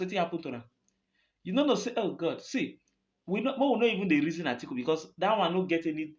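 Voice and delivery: usual speaking voice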